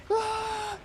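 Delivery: high, shrill mock shriek imitating a panicked player; imitating a character's terrified scream. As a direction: throw out a sudden high cartoon yelp. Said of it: "He's like".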